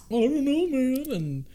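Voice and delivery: high, whiny trooper complaint; stormtrooper voice. Retold or imitated. Stormtrooper answering about his broken radio, high and whiny. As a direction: play the confused trooper. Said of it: "He's like".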